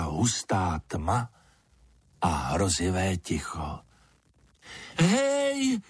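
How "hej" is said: high-pitched, drawn-out storybook call. A narrator's reading. Lizard calls into the dark for anyone there, calling out high. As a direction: call high into the emptiness, without really shouting. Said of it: "Zvolala ze všech sil".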